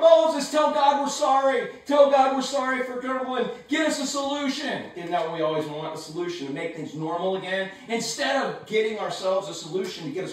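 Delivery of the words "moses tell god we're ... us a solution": crying out. Acted. Snake-bitten Israelites begging Moses for help, loud desperate cry. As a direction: beg Moses at the top of your voice. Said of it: "They cry out".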